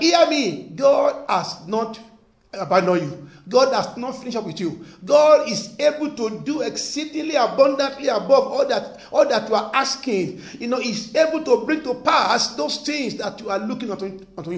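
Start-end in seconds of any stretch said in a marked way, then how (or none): 0.0-10.4 louder, rising call to the crowd; heated sermon build; preaching exhortation. Acted. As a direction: stir them up from the pulpit.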